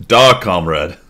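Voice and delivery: put-on hammy Russian accent, proud comrade. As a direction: play a boastful Soviet in Russian accent. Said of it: "That's as good as my Russian accent gets".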